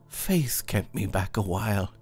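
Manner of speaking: put-on a touch of a startled young man's unease; understated tremor from sudden surprise; slight tremor. A narrator's reading. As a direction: answer with a hint of nervous tremor. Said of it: "with a tremor in his voice".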